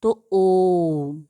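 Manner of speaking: ओम stretched very long, the vowel held; drawn-out om. Shown as a demonstration. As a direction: pull ओम out like a chant. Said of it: "खींचकर बोलने के लिए".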